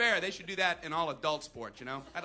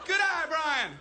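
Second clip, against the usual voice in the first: high, loud cheer; calling encouragement; 10 semitones higher, 5 dB louder, slower than usual. Acted. Team praises batter after near miss, calling encouragement. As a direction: holler praise much higher.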